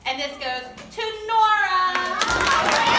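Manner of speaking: loud, high and slow, stretching out the reveal; drawn-out ringmaster call; grand announcement. Real, not acted. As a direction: build it up, then land the winner's name for the whole room. Announcing award winner to audience.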